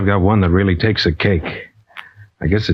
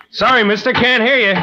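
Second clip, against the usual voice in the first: calling over hangar noise, pitched up; raised over noise. Acted. Pilot shouting to stranger over hangar racket. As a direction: push the voice over the engine din.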